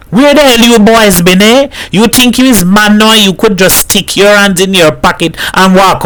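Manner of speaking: high-pitched, indignant interrogation; scolding Hat. A narrator's reading. Hat confronts the boys over smoking, scolding.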